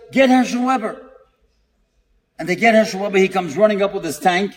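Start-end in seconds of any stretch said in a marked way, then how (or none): 0.1-1.0 mild mock yell. Neighbour calling for the first-aid man, mild mock yell. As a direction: retell a neighbour's shout without full volume. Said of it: "And somebody yells".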